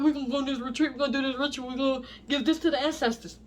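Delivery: lightly mock their retreat talk; mocking quote. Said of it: "all these people be talking about".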